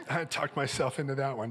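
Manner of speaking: ordinary delivery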